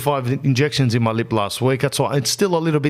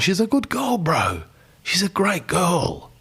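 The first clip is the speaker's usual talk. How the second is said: earnest plea; 4 semitones higher, slower than usual. Retold or imitated. Defending girlfriend to mum.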